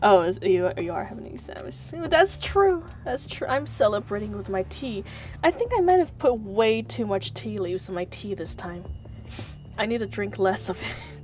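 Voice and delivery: usual speaking voice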